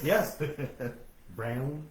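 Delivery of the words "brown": brown low and drawled like the South; a soft Southern brown. Retold or imitated. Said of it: "like she's from the South".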